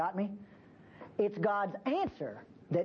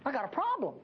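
prophet pleading; 4 semitones higher than usual. Retold or imitated. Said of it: "he's saying".

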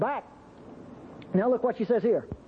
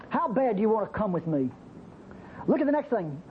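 ordinary talk, nothing marked

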